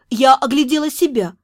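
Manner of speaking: usual speaking voice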